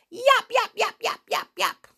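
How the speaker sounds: high, sharp little dog yaps; high yapping puppy barks. Acted. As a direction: bark it like excited small dogs. Dogs barking in the story.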